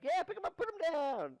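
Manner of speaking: high squeaky sidekick voice; squeaky echo. Acted. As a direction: parrot the boast in a silly high voice. Onlooker echoing the boast.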